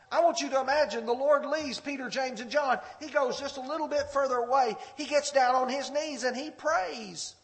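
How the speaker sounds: loud, high, fast sermon delivery; full-force preaching. Acted. Preacher painting the garden scene for the congregation, loud and driving. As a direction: drive the scene home to the congregation.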